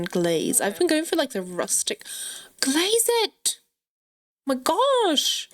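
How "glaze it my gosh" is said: high-pitched exasperated outburst; exasperated command. Real, not acted. Gamer scolding a disobedient sim, high exasperated outburst. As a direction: order it with voice shooting up, fed up.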